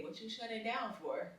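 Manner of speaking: same question, now gentle and quiet. Retold or imitated. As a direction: ask it again softly. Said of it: "So then I say".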